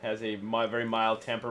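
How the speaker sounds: normal talking voice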